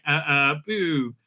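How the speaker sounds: ordinary talk, nothing marked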